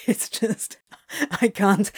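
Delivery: words broken by giggles; laughing while talking. Acted. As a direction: giggle through every line.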